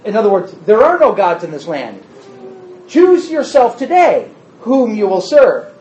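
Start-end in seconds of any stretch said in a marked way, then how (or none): 2.9-5.7 slow, pointed sarcastic challenge; mock the choice with slow irony; teacher voicing Joshua's sarcasm slowly; sarcastic quote. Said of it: "and that's the sarcasm".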